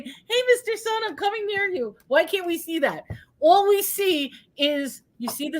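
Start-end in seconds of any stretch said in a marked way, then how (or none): 0.0-1.9 high, cheery little moon; happy moon voice. Pretend. Asking why video lacks the moon calling in a high cheery voice. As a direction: chirp it like a friendly toy moon. Moon puppet calls to the sun.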